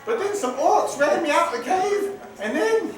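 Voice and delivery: high, rambling spirit voice; a giddy little ghost chattering. Acted. Spirit rambles about cave.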